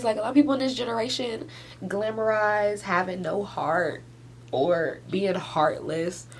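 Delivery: ordinary talk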